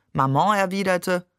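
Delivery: plain reading voice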